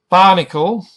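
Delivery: Barnacle with BAR punched high and loud; stress on first syllable. Shown as a demonstration. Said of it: "The first syllable is emphasized".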